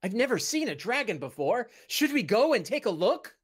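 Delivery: high, excited, lilting voice; eager companion character. Acted. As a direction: wonder aloud with rising eagerness. Game master voices companion Bing.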